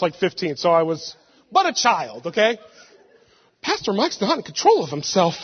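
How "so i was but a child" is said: ordinary talk, nothing marked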